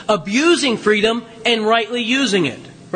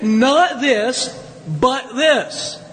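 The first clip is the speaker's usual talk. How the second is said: firm stress on both halves of "Not this, but this"; pause, then land "but this" as the opposite; emphatic contrast; 2 semitones higher, much slower than usual. Shown as a demonstration. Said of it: "It is an emphatic adversative".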